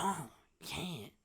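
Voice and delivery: put-on hushed, slow older brother; quiet brother. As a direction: warn him quietly. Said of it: "he's like".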